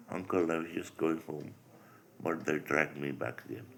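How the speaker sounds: ordinary delivery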